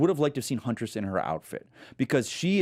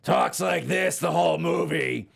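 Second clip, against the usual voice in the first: slow, over-the-top cartoon villain voice; 3 semitones higher, slower than usual. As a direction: ham it up, stretching every word. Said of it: "he's kinda this over-the-top, talks like this".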